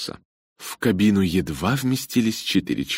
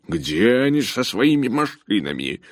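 raised, annoyed grumble; grumbling; 5 semitones higher than usual. A narrator's reading. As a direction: grumble the question out, pitched up. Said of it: "и проворчал".

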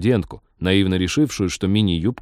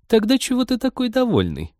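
high, puzzled question; 14 semitones higher than usual. A narrator's reading. Friend questions the pleased pilot, puzzled. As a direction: ask baffled, pitched way up. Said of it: "не поняла подруга".

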